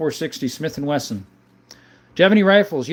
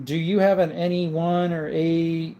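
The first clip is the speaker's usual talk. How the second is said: ordinary talk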